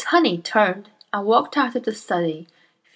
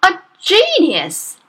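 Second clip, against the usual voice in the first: slow, high, loud outraged shout; 11 semitones higher, 7 dB louder, slower than usual. Acted. Outraged headmistress shouts at the teacher, high and slow. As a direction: blast the word back at her in disbelief. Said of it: "she shouted".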